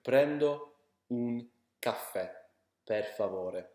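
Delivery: slow Italian drill of Prendo un caffè per favore; slow Italian repeat. Shown as a demonstration.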